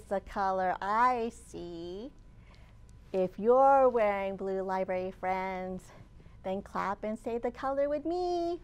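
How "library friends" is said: ordinary delivery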